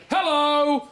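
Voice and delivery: hammed-up loud Hello!; booming hello. Dad greeting the bakery lady, booming. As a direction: boom out a Dickensian greeting. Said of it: "he said to the lady".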